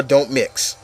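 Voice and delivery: ordinary talk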